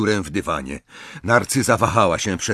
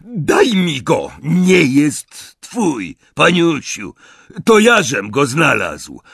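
raised, higher quarrelling voice; heated quarrel; 6 semitones higher, 5 dB louder, slower than usual. A narrator's reading. Snatcher squabbles with Bellatrix over the sword, heated. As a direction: argue loudly over who owns it.

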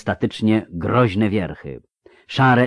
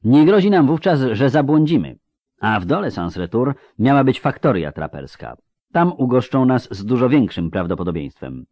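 assured, raised-voice reasoning; know-it-all tone; 5 semitones higher, 5 dB louder than usual. A narrator's reading. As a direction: explain as if you know best. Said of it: "wszystko wiedzącym tonem Regis". (wszystkowiedzącym tonem)

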